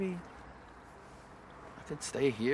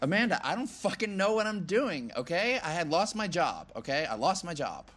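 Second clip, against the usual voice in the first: higher, louder, frustration boiling over; exasperated outburst; 7 semitones higher, 5 dB louder than usual. Acted. Man snapping at Amanda over her questions, exasperated outburst. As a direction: burst out, pitch high then falling.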